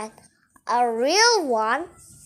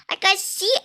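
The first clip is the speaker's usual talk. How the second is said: ordinary delivery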